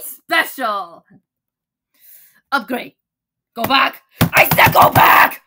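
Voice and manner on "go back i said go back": loud, urgent shout; growing shout; yelling go back. Acted. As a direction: order them back, louder the second time.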